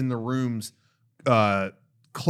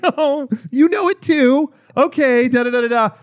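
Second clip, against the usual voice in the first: playful imitation of a cheery hotel robot; chirpy robot assistant; 9 semitones higher, 6 dB louder than usual. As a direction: gush like a delighted AI helper. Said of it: "She's like".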